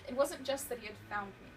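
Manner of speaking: ordinary delivery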